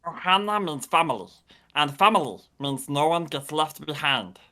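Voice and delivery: rough alien creature voice; Stitch voice. Shown as a demonstration. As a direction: croak it like a small alien. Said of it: "Oh my god, that's amazing".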